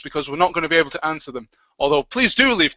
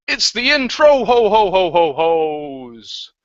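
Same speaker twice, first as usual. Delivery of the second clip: jolly ho ho ho; 2 semitones higher, 3 dB louder, slower than usual. Acted. Jolly Santa laugh intro.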